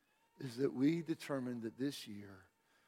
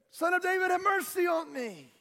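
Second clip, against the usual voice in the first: crying out; 8 dB louder than usual. Acted. Blind Bartimaeus calls to Jesus, crying out loudly. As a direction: holler for mercy over a crowd. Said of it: "he begins to cry out".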